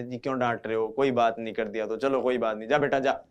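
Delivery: calm the angry father down softly; soothing mother. Retold or imitated. Mother soothes angry father, gentle and soft. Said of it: "mummy आती है और बोलती है".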